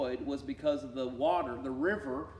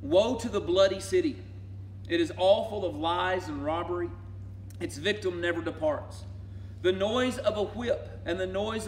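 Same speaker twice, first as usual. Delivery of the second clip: prophetic proclamation; 3 semitones higher, 3 dB louder than usual. Acted.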